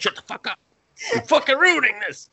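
high-pitched crabby outburst; retold cranky complaint, building; mock cranky old man. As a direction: ham up an old man's irritation, pitch jumping up. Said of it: "I went from sad to cranky old man".

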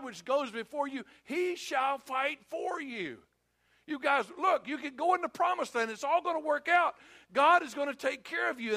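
high, forceful preaching plea; urgent reassurance. Acted. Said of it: "tell them that they shouldn't be afraid".

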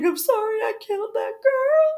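squeaky sobbing apology, imitating him; high weepy killer voice. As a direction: whimper it like the weeping killer. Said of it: "his voice is super high pitched".

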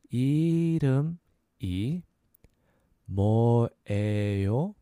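이름이 뭐예요 slowly in separated pieces; slow syllable breakdown. Shown as a demonstration. Teacher breaking down "what's your name", slow syllables. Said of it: "So a little faster".

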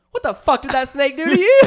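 high, loud disbelieving outburst; reacting to brother hurting the snake, outraged disbelief; incredulous outburst. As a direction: demand it in disbelief. Imagined incredulous reaction now.

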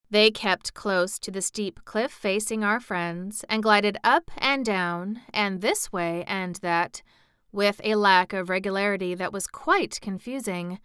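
ordinary delivery, nothing marked

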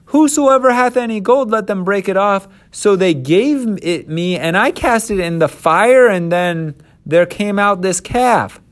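high-pitched, emphatic excuse; defensive Aaron. Acted. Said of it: "this is Aaron still".

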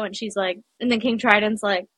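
usual speaking voice

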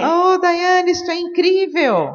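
mock excited gushing. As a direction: gush like a thrilled partner, hammed up. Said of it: "me escreviam no WhatsApp".

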